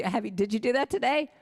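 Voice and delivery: normal talking voice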